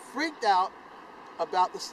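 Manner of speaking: ordinary delivery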